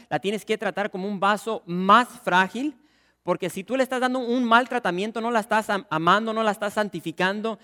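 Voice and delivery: loud, driving pulpit voice; fervent preaching. Acted. Pastor exhorting husbands from the pulpit, loud fervent preaching. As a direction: preach it hard to the congregation. Preaching loudly on honouring wives.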